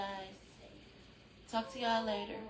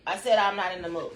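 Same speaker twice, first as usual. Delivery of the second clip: said punched, louder and firm; louder firm repeat; 7 dB louder than usual. Real, not acted. Repeating her refusal to Lavin, louder and firmer.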